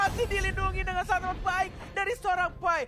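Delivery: high, loud excited play-by-play; fighting game announcer. Acted. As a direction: build the call to a peak. Caster calls a teamfight.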